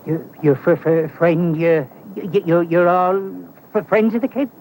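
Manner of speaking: stammering in sudden alarm; nervous stammer. Acted. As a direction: trip over the words as it dawns on you. Learns they are Kid's friends.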